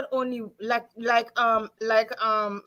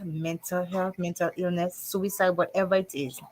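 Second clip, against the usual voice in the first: ordinary delivery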